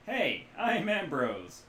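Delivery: slow, loud, high hearty hello; cheerful shout. Acted. A drunk rigger shouts a greeting to visitors, cheerful and loud. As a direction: bellow a cheerful greeting as Ambrose. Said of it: "he shouts cheerfully in English".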